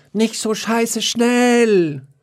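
very high, slow howled mock whine; howling. A narrator's reading. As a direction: howl it out slowly to tease. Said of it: "Da jaulte Wiesel". (sehr hohes, langsames Jaulen)

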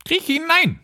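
very high-pitched witch order; commanding witch. Acted. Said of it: "sagte die Hexe". (befehlende Hexe)

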